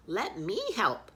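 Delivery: high, bright-toned goose offer. Acted. Snow goose offers to help, high and eager. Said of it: "she says".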